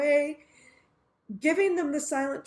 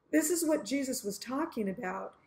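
ordinary talk, nothing marked

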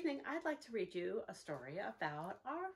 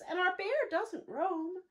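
mouse squeak; 8 semitones higher, 8 dB louder, slower than usual. Acted. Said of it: "Mouse squeaks".

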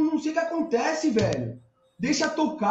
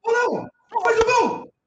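urgent yelling; 10 semitones higher, slower than usual. Real, not acted. Urging a shot.

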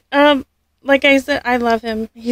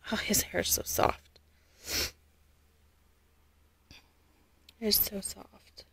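barely voiced, tender, so as not to wake him; hushed; 15 dB quieter than usual. Real, not acted. Showing the new baby to viewers, hushed and tender. Cooing over reborn doll, hushed.

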